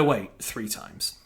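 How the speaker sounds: ordinary talk, nothing marked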